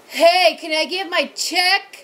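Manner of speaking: loud mock yell at the waitress; retold yell. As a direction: bark it across the diner, slowed. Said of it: "he just yells".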